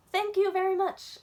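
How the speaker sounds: put-on a kid thanking an imaginary class; pretend teacher bow. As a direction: take your bow like a star. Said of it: "I said".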